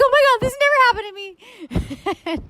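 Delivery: imitating her panicked self on the plane; retold panic. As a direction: replay her own panic attack, half-joking. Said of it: "a mini panic attack".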